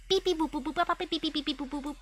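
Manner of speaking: quick, high-pitched beeping; hurried robot beeps. Acted. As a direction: rush the beeps like an anxious robot. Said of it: "Hurried beeping noises".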